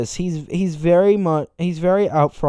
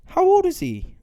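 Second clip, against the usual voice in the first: pitched way up in disbelief; incredulous high squeak; 14 semitones higher than usual. Real, not acted. Reacting to the boy writing a letter, squeaky disbelief.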